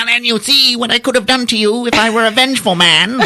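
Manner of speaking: high, pompous goose voice; talking goose. Retold or imitated. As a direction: brag like a smug goose. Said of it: "the goose talks".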